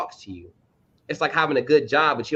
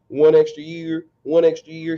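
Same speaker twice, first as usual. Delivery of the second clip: imitating player; 3 semitones higher, 6 dB louder than usual.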